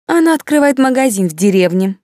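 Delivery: high, derisive gossip voice; huffy, disdainful report; scornful snort. A narrator's reading. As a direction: scoff at it, pitch lifted. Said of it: "фыркнула баронесса".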